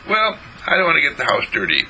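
put-on little boy voice. John answers in story.

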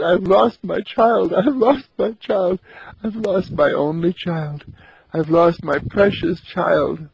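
put-on keen over a lost child; mother crying in her hut without looking up, wailing; sobbing mother. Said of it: "so deep in sobbing and crying".

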